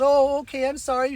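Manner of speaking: high-pitched mock apology; apologetic woman, imitated. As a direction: mimic her flustered backing down. Rebuffed woman apologizes, voiced.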